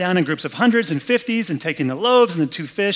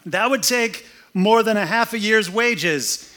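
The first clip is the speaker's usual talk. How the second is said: higher, slow, dry sarcastic jab; sarcastic retort; 7 semitones higher, 3 dB louder, slower than usual. Acted. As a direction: needle him with mock practicality. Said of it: "this is called sarcasm".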